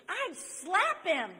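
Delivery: high, loud, drawn-out retort; indignant outburst. Retold or imitated. Girl in the back reacting to the used ring, indignant outburst.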